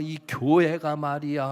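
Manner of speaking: loud, slow imitated scolding; retold tirade. Church member scolding the pastor, imitated loud rant. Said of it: "퍼붓는 거예요".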